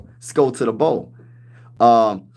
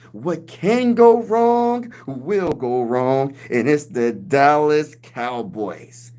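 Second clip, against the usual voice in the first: Stephen A. Smith impression; much slower than usual. Streamer imitating a pundit mocking the Cowboys, very slow. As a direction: stretch every word like a TV pundit. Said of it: "he's gonna talk about the Cowboys like this".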